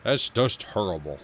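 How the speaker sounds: a light take on a booming basketball analyst; Charles Barkley impression. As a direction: lightly mimic the TV pundit's verdict. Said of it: "As Charles Barkley would say".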